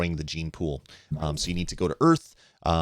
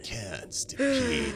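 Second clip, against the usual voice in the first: mock alien voice; 4 dB quieter, much slower than usual. Echoing the alien's word.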